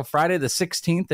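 ordinary delivery

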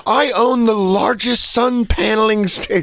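high, whining self-justification; stressed-out victim act. Acted. Confronted heckler playing the victim card, high stressed-out excuses. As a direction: plead your case like a flustered big shot. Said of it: "I'm very stressed out right now".

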